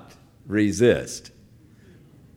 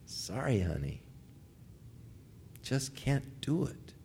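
gentle refusal; 12 dB quieter than usual. Acted. Grandpa gently refusing granddaughter.

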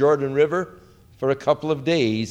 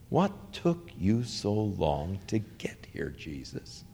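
whisper; 5 semitones lower, 10 dB quieter, slower than usual. Retold or imitated. Martha's unspoken reproach.

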